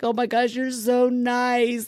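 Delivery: high, drawn-out weepy gushing; mock tearful gratitude. Crash victim thanking kind paramedic, mock tearful. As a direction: imitate a slow grateful whimper. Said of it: "uncontrollably sobbing".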